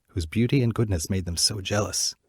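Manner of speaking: plain reading voice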